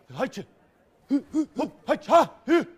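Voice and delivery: loud, high hop-and-hatch yelps; comic energetic whoops. Acted. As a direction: burst out with silly energy cries. Bursts of excited energy noises.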